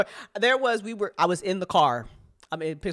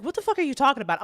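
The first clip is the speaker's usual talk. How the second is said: fast, incredulous retort; mock disbelief at the request; incredulous girlfriend; 8 semitones higher, 5 dB louder, faster than usual. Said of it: "she's like".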